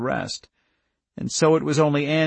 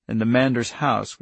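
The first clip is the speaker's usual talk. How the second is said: ordinary delivery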